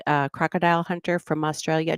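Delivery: ordinary talk, nothing marked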